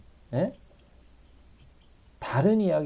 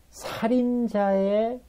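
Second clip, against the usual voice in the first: "살인자의" stretched very slowly and lifted higher; drawn out; 9 semitones higher, much slower than usual. Shown as a demonstration. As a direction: draw out "살인자의" slowly, rising. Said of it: "잘 보세요". ("살인자의"를 아주 천천히 끌며 높게)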